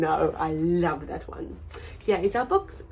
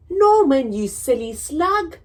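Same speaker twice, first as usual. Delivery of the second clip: mild scolding; 7 semitones higher, 9 dB louder than usual. Acted. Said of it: "they cried".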